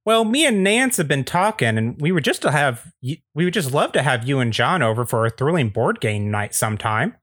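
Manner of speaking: folksy neighbor, starting high and settling; Andy Daly. Acted. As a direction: invite them over with neighborly chumminess. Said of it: "is played by Andy Daly".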